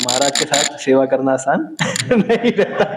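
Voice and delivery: saying it through a laugh, rather fast; laughing quote. Retold or imitated. Devotees joking about serving the Maharaj, laughing. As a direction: giggle through the complaint. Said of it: "ऐसे कई कई बोलते हैं ना".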